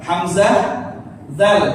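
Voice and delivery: usual speaking voice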